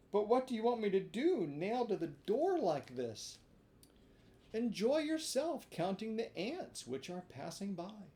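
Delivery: put-on high, quick puppet boy; high hungry marionette voice; complaining Pinocchio. A narrator's reading. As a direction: plead from stuck in the door. Pinocchio stuck in door.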